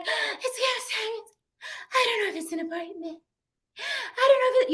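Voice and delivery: hysterical panic. Retold or imitated. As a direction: imitate a breathless, crying woman in a panic. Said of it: "She is hysterical".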